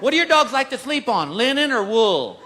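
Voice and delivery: lightly voiced chirpy counter girl; mock sweet desk girl. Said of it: "a little girl behind the counter goes".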